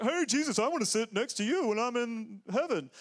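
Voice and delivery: high, loud needy whine; whiny disciple. Retold or imitated. Disciple pestering Jesus, high whiny plea. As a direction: beg like a clingy follower.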